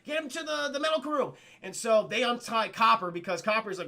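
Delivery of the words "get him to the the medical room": high-pitched, stammered orders; hammed-up emergency scramble; mock urgent panic. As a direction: call out for help with a stricken man. Acted urgency over collapsed man.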